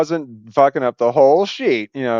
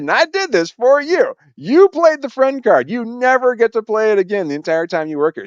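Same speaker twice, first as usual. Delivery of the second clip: high indignant lecture; 10 semitones higher than usual. Retold or imitated. Warning the coworker she never plays that card again, indignant and high. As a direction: drive it home, indignant. Telling her the friend card is spent.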